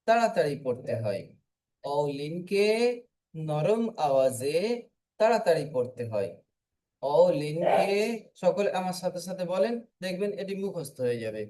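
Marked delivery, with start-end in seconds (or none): none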